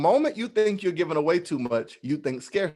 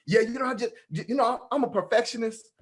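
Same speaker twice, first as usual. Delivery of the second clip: mock self-excuse; 6 semitones higher than usual. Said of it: "label yourself a perfectionist".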